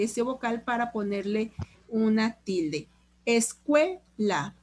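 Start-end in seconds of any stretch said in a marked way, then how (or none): 3.3-4.6 "Escuela" slowly syllable by syllable, "cue" drawn out; stress on CUE. Shown as a demonstration. Teacher quizzing class on the tonic syllable, "Escuela" slow, stressing "cue". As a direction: break up "Escuela", leaning on "cue". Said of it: "¿Cuál es la sílaba tónica?".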